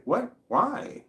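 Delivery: high shocked squeak; shocked why. Retold or imitated. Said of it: "And she's like".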